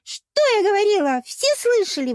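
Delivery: very high, loud gloating cry; ликующе. A narrator's reading. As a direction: crow in triumph to the whole crowd. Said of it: "возликовала русалка". (ликующий возглас)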